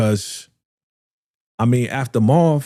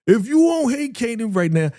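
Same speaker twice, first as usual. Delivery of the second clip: high mock outrage; 10 semitones higher than usual. Mock outraged rant at viewers.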